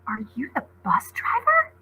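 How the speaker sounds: squeaking. Acted. Frightened girl asking the beast, squeaking. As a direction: ask it small and frightened.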